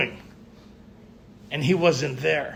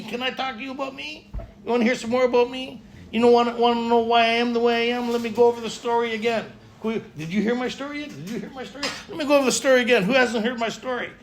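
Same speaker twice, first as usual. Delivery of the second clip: higher-pitched, hurried mock pestering; self-absorbed pesterer; 6 semitones higher, faster than usual. Member cornering listeners to retell their story, mock self-absorbed pestering. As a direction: play someone desperate to retell their own story.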